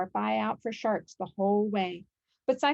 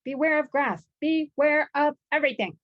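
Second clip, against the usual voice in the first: higher, louder worried warning; anxious warning; 6 semitones higher, 6 dB louder than usual. A narrator's reading. Cyclops warning his flock, high anxious cry. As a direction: fret loudly over every danger. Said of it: "he warned his flock".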